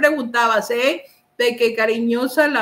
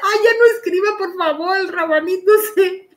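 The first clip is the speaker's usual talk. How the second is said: laughing plea; 6 semitones higher, faster than usual. Real, not acted. Pleads through laughter.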